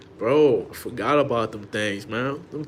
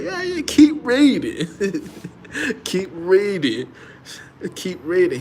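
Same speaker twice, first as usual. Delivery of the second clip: mocking wife; 8 semitones higher, 5 dB louder, slower than usual. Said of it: "She be like".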